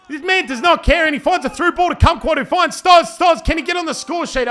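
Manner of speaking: high, shouted play-by-play; rising with the attack; excited build-up. Real, not acted. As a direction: call the through ball at full pitch.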